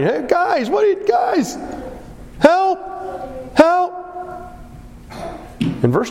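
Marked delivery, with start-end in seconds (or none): 0.0-3.9 high desperate calls from the pit; shouting for help. Retold or imitated. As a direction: cry up out of the pit to the brothers. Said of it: "You can imagine him shouting".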